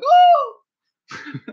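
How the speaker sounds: squawking high seagull cries; seagull impression. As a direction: shriek like a gull. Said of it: "is that your bird impression".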